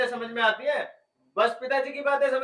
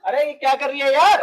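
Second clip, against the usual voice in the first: high, loud yelp of objection; sudden raised-voice complaint; loud startled protest; 5 semitones higher, 9 dB louder than usual. Acted. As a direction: cry out in annoyed surprise. Startled as wife reaches for ear.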